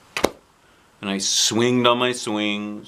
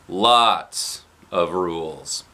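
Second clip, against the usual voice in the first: lower Daddy voice; 5 semitones lower, much slower than usual. A narrator's reading. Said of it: "said Daddy".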